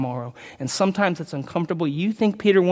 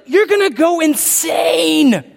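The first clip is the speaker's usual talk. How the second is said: preaching shout; 11 semitones higher, 6 dB louder, much slower than usual. Real, not acted. Preaching about pleasing everyone.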